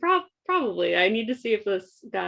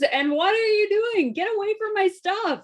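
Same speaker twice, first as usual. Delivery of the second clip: higher put-on character voice; other voice; 8 semitones higher than usual. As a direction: switch to a higher silly voice. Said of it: "I'm switching to my other voice".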